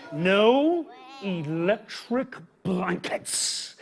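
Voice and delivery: very slow, each word stretched; drawn-out refusal. Acted. Reading the farmer's refusal to kids, very slowly. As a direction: drag out every word. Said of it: "Now they wanted electric blankets".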